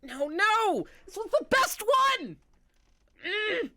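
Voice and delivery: high, loud anguished cry; distraught wail. Acted. Elf maker wails over her broken creation, loud and high.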